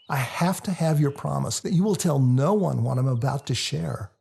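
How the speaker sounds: ordinary delivery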